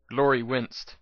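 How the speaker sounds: ordinary delivery, nothing marked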